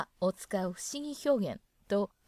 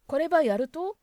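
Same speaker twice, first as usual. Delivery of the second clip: Kyushu dialect; 5 semitones higher, 7 dB louder than usual. Retold or imitated. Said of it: "九州の人は確か使う".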